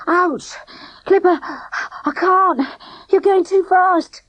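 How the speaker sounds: high, loud breathless complaint; out of breath from running; breathless protest. A narrator's reading. Boy dragged running, protesting.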